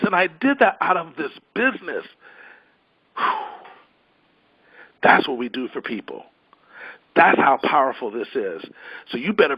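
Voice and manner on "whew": a high, breathy "whew" of release; whew of relief. Real, not acted. Speaker to call listeners after closing pitch, exhausted whew. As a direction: blow out the tension after the big finish.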